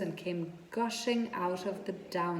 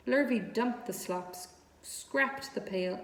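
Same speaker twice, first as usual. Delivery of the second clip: usual speaking voice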